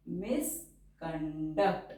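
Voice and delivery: stretched out, syllable by syllable; slow dictation. Shown as a demonstration. Teacher dictating a shorthand word to the class, slowly. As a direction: say it slowly for writing along.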